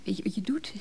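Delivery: urgent hushed whisper. Acted. Wife whispering a warning to Johan in the quarrel. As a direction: breathe it at him, barely voiced. Tense quarrel between a couple.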